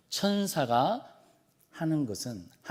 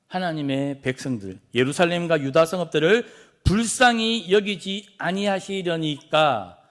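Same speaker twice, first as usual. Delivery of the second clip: raised, louder appeal to God; impassioned plea; 3 semitones higher, 6 dB louder than usual. Acted. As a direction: plead forcefully on behalf of the people. Acting angel's plea to God.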